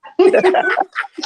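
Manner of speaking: loud, high burst of laughter; a booming belly laugh; big laugh. Real, not acted. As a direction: laugh out loud at your own story. Laughs at his own retelling.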